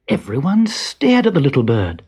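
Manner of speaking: plain reading voice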